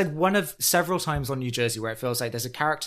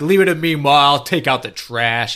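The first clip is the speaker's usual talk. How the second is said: mock tough guy; 5 dB louder than usual. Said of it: "puffing his chest, being like".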